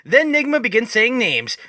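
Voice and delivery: plain reading voice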